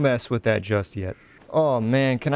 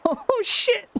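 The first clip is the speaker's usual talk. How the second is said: high startled cry; startled yelp. Real, not acted. Player startled by something in the game, high yelp. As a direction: jump and blurt it out.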